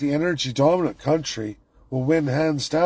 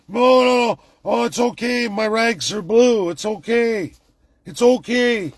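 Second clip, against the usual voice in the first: higher, louder mock reassurance; mock reassuring victim; 7 semitones higher, 3 dB louder than usual. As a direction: parody someone calming themselves in denial. Mocking complacent prisoner voice.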